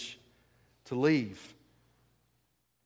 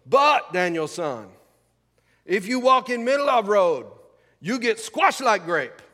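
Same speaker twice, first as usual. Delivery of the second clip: Mister Miyagi; 5 semitones higher, 6 dB louder than usual. Acted. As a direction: do a stern mentor in a put-on Japanese accent. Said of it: "in my best Japanese accent".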